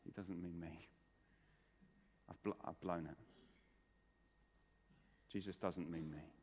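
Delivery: dejected, low and hushed self-doubt; Peter. Acted. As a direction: think it aloud, quietly defeated. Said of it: "Peter would be thinking".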